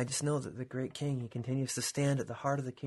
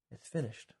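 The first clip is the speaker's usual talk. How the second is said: quiet; 3 semitones lower, 5 dB quieter than usual. Real, not acted. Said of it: "quiet restatement to listeners".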